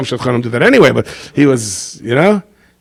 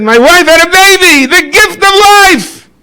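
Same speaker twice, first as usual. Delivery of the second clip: much higher, very slow, ringing voice; Eliyahu's joy; 7 dB louder, slower than usual. Retold or imitated. Eliyahu tells the poor couple of his baby, high and slow. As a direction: savour the gift of life, stretched out.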